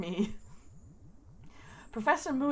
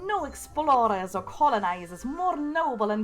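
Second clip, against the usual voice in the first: put-on slow, louder, R's rolled in a grand queenly accent; queen's rolled-R accent; 5 dB louder, slower than usual. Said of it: "Then why did I read it in her accent?".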